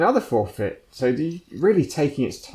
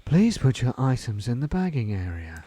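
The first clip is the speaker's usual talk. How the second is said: sultry checkout machine voice; a hint of a seductive automated announcer; sultry narrator. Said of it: "who's got a sultry voice".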